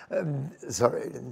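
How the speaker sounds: real choked-up apology; trying to recover from welling tears; emotional, tearful. Real, not acted. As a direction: apologize softly through happy tears. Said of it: "made you so emotional".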